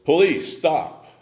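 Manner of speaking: high, loud shouted command; imitated shout, pitched well up; retold police yell. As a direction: call it out like a cop halting someone. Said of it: "and somebody yells".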